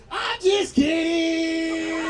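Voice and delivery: high, long drawn-out yell; gleeful cop shout. Retold or imitated. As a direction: crow the prank in a singsong shout. Cop reveals the prank.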